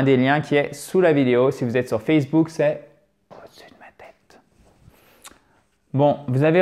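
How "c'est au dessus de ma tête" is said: whisper. Real, not acted. Whispered aside about the link.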